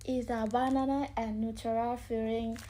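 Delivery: ordinary talk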